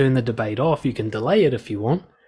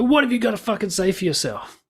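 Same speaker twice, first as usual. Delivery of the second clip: pitched high, a hostile snap at you; mocking an aggressive accuser, raised pitch; mock angry challenge; 8 semitones higher than usual. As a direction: imitate someone spoiling for a fight. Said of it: "Somebody's like".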